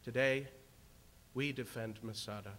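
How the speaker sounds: ordinary talk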